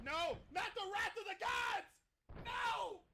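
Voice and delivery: high, loud cry of dismay; dismayed shout. Real, not acted. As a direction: yell in horror at the move. Dismay at big move.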